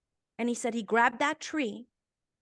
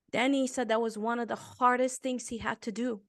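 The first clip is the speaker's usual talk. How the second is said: ordinary talk, nothing marked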